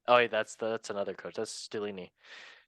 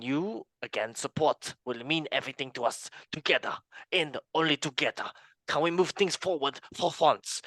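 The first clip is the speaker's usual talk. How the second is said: playful, higher-pitched fake French accent; 3 semitones higher than usual. Host reading the players' apology to fans, mock French accent. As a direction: read it out in a hammed-up French accent. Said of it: "oh, I have to do a French accent".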